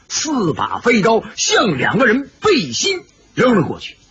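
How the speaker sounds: plain reading voice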